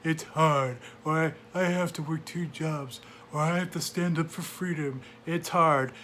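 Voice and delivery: lightly whiny, slowed excuses; whiny complaint. Retold or imitated. Mocking complacent voters, a lightly whiny voice. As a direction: moan it like a put-upon voter. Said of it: "We whine and moan".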